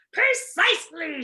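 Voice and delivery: loud, slow, stern shout. Acted. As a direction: bark the word out. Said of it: "shouted the dog".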